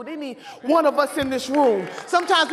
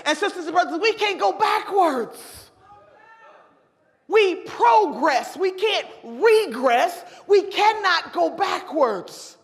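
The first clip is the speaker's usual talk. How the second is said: slow, drawn-out rally preaching, each word landed; 4 semitones higher, 3 dB louder, slower than usual. Acted. Rallying convention crowd against going backwards, slow preaching. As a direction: preach it slowly to the hall, word by word.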